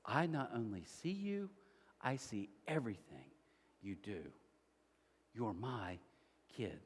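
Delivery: God comforts someone who feels unseen, tender. Acted. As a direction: speak it like a father to his child. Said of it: "And God says".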